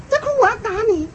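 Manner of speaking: mock motherly threat, pitched high; threatening mother. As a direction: imitate a mom warning a crying child. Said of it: "然后他妈就一直说". (模仿妈妈高声吓唬孩子)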